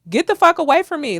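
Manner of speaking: real irritation rising in the voice; aggressive. Real, not acted. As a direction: push it out, heated and emphatic. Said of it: "you see how aggressive I get when I talk".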